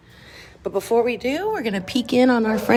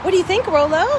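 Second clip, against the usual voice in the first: talking to a dog; 7 semitones higher than usual. Real, not acted. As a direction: ask the dog brightly. Asking dog to walk.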